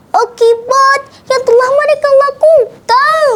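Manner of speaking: high-pitched, tearful indignant grumble; Ciko grumbling. A narrator's reading. Ciko scolding irresponsible humans, high upset grumble. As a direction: complain bitterly in a high character voice. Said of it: "gerutu Ciko".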